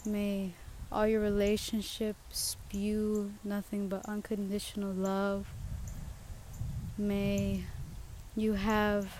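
slow, soft inspirational send-off; gentle blessing. Acted. Podcaster blessing her listeners, slow soft inspirational tone. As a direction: bestow wishes slowly like a benediction.

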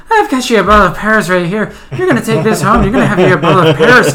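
loud, high generic Irish granddad; Irish accent. Retold or imitated. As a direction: play an insistent Irish grandfather. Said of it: "like, a generic Irish accent".

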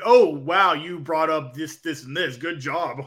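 sarcastic. Shown as a demonstration. Co-host showing how a heel would praise a promo, sarcastic. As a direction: demonstrate exaggerated fake admiration, drawing the words out. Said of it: "if there's a sarcastic tone".

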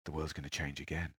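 very soft, deep prophecy; low, quiet and portentous. Retold or imitated. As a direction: imitate a guru dropping to a hush. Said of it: "his voice goes down again".

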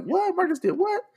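high, loud, disbelieving what; mock stunned reaction from fans; shocked listener. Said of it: "everybody at home like".

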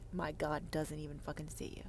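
harsh unvoiced whisper. Real, not acted. Condemning the Christians, whispered. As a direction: hiss the verdict softly. Hushed damning line.

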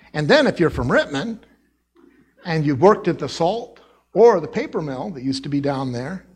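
ordinary talk

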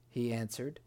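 usual speaking voice

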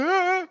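high mock scream; scared scream. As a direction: yelp and run away. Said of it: "the guy goes".

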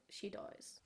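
dropped to an unvoiced whisper. Shown as a demonstration. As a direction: let it fall to a grim whisper. Ominous refrain in ransom note.

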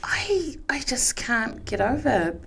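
stunned whisper. Real, not acted. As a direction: breathe it out, aghast. Stunned by video.

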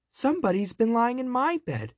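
very high, excited little bear; shrill baby bear delight; joyfully squeaking baby bear. A narrator's reading. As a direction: squeak it with glee like a bear cub. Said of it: "squeaked the baby bear joyfully".

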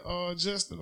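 ordinary talk

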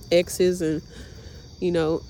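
ordinary delivery

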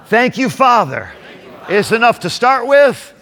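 preaching. Acted. Preacher builds congregation up.

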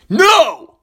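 put-on loud, high toddler's refusal; toddler's first words. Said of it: "But what comes next?".

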